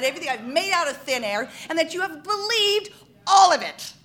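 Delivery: high, loud hammed-up confession; exasperated outburst. Fortune teller imagining telling off the gullible crowd, exasperated outburst. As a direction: let it all blow, high and loud.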